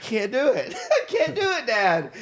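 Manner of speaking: put-on scared kid pleading through laughter; high, laughing plea; giggling whine of a scared ten-year-old. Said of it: "I was like".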